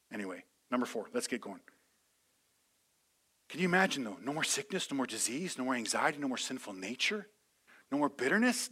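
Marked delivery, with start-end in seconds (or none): none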